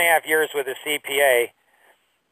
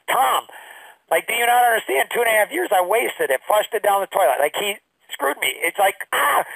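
higher, exasperated, mildly raised retelling; 6 semitones higher than usual. Retold or imitated. Telling mentor Tom about the partner, exasperated raised voice. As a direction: vent the wasted years at him. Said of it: "I raised my voice".

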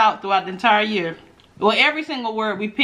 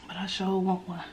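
hushed; 2 semitones lower, 10 dB quieter than usual. Real, not acted. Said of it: "I don't know why I'm whispering".

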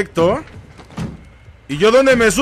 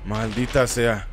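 cursing under breath; 6 semitones lower, 7 dB quieter, slower than usual. Real, not acted. Frustrated curse.

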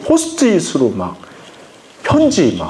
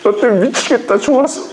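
laughing fondly; 6 semitones higher than usual. Pretend. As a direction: laugh through the words. Doting parent laughing while saying it. (웃으면서 애정 어린 말투로)

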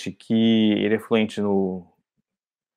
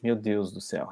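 soft, much quieter "my God"; muttered dismay; 3 semitones lower, 12 dB quieter than usual. Retold or imitated. Flustered tourist at the ticket counter, mutters softly in dismay. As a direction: say it to yourself under your breath. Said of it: "eu falei assim".

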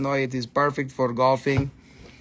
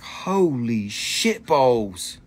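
slow, drawn-out imitation of shock; mock astonishment; 4 semitones lower, much slower than usual. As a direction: stretch each word in retold amazement. Retells employee's shock at seeing eagle.

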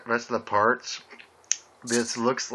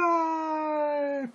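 high, long-drawn strained cry; acted yell; much slower than usual. Reader as Pinkie struggling to break free, drawn-out high yell. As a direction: stretch the name out in a mock yell. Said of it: "Pinkie yelled".

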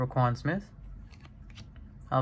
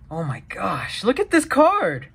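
surprised; 5 semitones higher than usual. Real, not acted. Surprised by a card.

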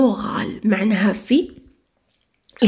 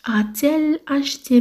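ا تيل اشتي said very slowly with the t in تيل sounded; teacher modelling the inversion slowly; slow model question; 5 semitones higher, slower than usual. Shown as a demonstration. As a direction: dictate the inverted question word by word. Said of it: "ما نقولوش ا ال اشتي".

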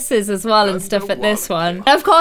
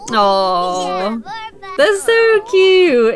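drawn-out gushing aww; cooing; 5 semitones lower, much slower than usual. Real, not acted. Cooing over the kids.

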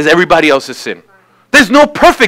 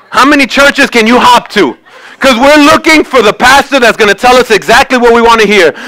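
normal talking voice

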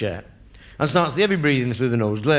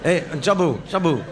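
put-on higher drunken call; 4 semitones higher than usual. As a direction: shout for Sabu like a drunk. Drunk calls waiter again.

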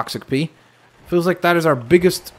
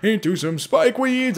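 high, sing-song mocking voice; playful imitation of a scheming opponent; mock sneaky enemy; 7 semitones higher than usual. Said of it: "He's like".

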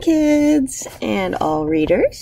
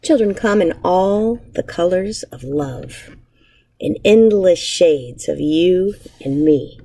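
put-on reading to children. Teacher reading a picture book aloud to her class, gentle storytelling. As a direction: savour each image for young listeners.

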